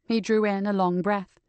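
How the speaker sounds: plain reading voice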